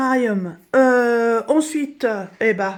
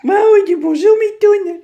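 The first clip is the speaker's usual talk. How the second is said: pet voice; 11 semitones higher, 9 dB louder than usual. Real, not acted. Owner voices the cat's hello in loud, high baby talk. Speaking for the cat to cat.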